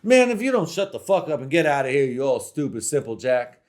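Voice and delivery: loud, fast telling-off; a loud, quick mock dismissal; mock angry snap. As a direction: cut him off, hammed-up fury. Fan snaps at critic.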